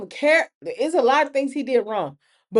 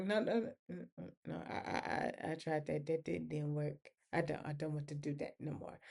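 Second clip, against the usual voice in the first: softer, almost whispered no's; quiet refusal; 8 semitones lower, 17 dB quieter than usual. Retold or imitated. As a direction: back away from the idea softly. She gives up on police.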